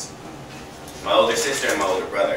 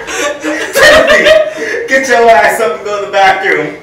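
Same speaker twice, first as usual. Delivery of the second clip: name called high and loud, laughing through the order; loud call with laughter; 9 semitones higher, 10 dB louder, slower than usual. Retold or imitated. Grandmother waking Timothy to blame him, laughing loud call. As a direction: holler the name then scold, cracking up.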